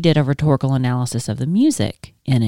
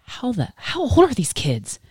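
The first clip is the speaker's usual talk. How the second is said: shocked disbelief; 9 semitones higher, 4 dB quieter than usual. Retold or imitated. Retold thought, shocked.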